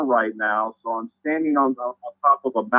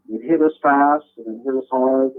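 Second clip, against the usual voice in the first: ordinary talk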